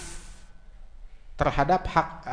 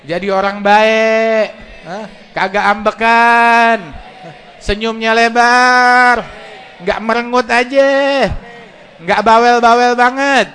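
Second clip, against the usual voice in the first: loud, raised, drawn-out wishes; shouted prayer; 5 semitones higher, 10 dB louder than usual. Real, not acted. Preacher leading men in prayer for their wives, shouted. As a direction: belt out each wish, stretching the last word.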